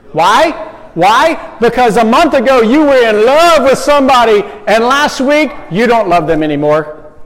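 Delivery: loud, high preaching burst; preaching at full force. Acted. Preacher challenging middle schoolers, loud and high. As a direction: fire up the room with the question.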